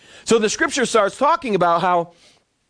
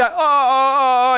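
mock panic; 5 semitones higher, much slower than usual. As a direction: play the servant seeing the army, alarmed. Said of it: "he starts freaking out".

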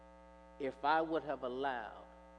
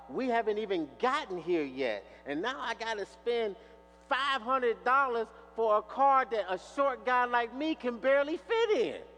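complaining; 5 semitones higher, 6 dB louder than usual. Retold or imitated. Imagined complaint about the car.

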